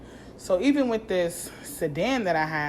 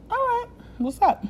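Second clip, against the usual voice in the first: much higher, bright put-on "all right, what's up"; mock cheery greeting. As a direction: greet them in a fake sweet high voice. Greeting at the rental spot.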